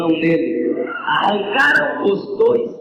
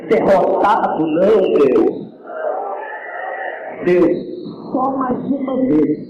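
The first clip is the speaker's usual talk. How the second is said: desperate prayer; 3 semitones lower than usual. Acted. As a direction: cry out to God, broken. Samson's prayer for strength.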